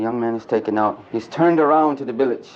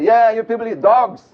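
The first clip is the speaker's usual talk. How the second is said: higher, mocking jeer at the other clan; mocking rival clan; 9 semitones higher, 4 dB louder than usual. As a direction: taunt the dog eaters, clearly higher. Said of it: "the other guy say".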